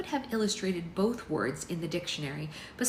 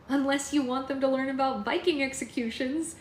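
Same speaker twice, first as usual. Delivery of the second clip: high, bright-pitched boasting; eager girlfriend; 8 semitones higher, 4 dB louder than usual. A narrator's reading. Poppy promoting Alex to her parents, high and eager. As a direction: pipe up high for your partner.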